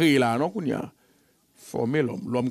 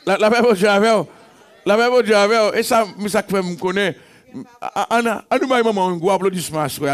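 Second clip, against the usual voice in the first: loud and higher, calling out to the church; fervent preaching; 6 semitones higher, 4 dB louder than usual. Acted. Pastor calling to the beloved, preaching loudly. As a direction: rouse the beloved.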